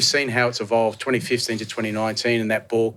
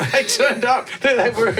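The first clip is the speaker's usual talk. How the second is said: laughing; 11 semitones higher, 4 dB louder, slower than usual. Real, not acted. Laughing while retelling the surprise.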